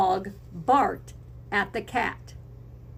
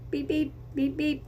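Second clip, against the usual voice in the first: horn beeps; 6 semitones higher, 3 dB quieter than usual. Shown as a demonstration. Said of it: "make a horn honking sound".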